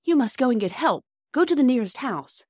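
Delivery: high, loud, urgent instruction; quick urgency. A narrator's reading. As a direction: urge them to fetch help. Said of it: "said Bobby quickly".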